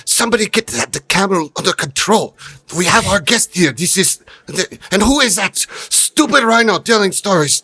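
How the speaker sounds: high, loud frantic host shouting orders; frantic yelling. Acted. Host yelling to his staff, frantic and loud. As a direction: yell back over your shoulder in a panic.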